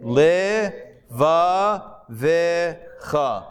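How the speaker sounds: Levavecha drawn out very slowly, every syllable apart; careful reading. Shown as a demonstration. Teacher sounding out Levavecha for the class, very slowly. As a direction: spell out Levavecha slowly, le-va-ve-cha. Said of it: "Look at that third to last word".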